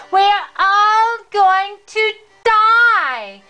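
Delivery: plain reading voice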